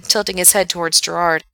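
ordinary delivery

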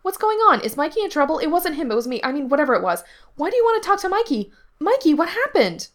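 very high, loud panicky questioning; agitated kid, pitch way up; frantic demanding. A narrator's reading. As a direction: demand answers in a rising fluster. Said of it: "he demanded".

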